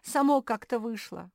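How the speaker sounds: high, loud, upset excuse; distressed, plaintive whine; acted sobbing. A narrator's reading. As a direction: wail that it wasn't on purpose. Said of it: "зарыдала мопсишка".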